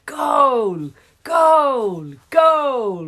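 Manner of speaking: big loud roaring goal cheer; crowd roar. Acted. The crowd cheering Henry's goal, loud roar. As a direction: roar it like a whole stadium. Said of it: "roared the crowd".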